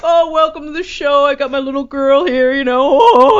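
high, loud diva talking impression. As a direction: push it up high with a swelling last word. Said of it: "she would have that thing in her voice".

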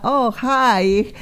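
ordinary talk

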